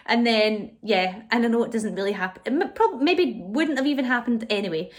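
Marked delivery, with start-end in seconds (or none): none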